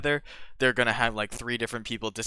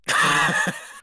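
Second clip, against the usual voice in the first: loud laugh; 6 semitones higher, 9 dB louder than usual. Real, not acted. Laughing at aneurysm joke.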